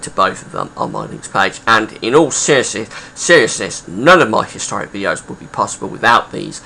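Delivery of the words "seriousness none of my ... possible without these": ordinary talk, nothing marked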